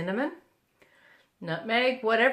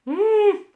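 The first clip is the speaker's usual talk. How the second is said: loud, high delighted mmm of tasting; yummy mmm; 13 semitones higher, 8 dB louder than usual. Real, not acted. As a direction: savour the bite out loud.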